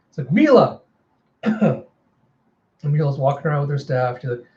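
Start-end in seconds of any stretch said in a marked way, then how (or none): 0.3-0.8 high-pitched shout of a name; acted urgent call. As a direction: yell after a child running off. Calls after the girl.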